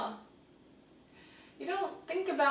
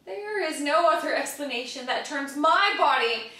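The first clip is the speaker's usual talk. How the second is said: building outburst; 6 semitones higher, 6 dB louder than usual. Acted. Sick patient insisting on an explanation, building to an outburst. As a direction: start firm, build to a high, loud cry.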